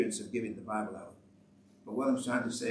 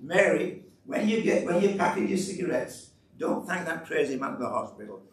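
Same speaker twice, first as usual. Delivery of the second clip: calling loudly after her, higher and louder; retold shout; 5 semitones higher, 6 dB louder than usual. To Mary walking away down the hospital, a loud call. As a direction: call out across the hospital lobby. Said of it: "I shouted".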